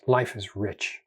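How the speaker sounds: soft, easy and quiet; relaxed mind's gentle thought; calm inner voice. Retold or imitated. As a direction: murmur it like a contented mind. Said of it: "the unstressed thinking mode is".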